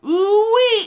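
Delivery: high, loud gleeful whoop; excited whoop. Acted. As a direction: whoop it out like a delighted old cowboy. Excited exclamation over steak sauce.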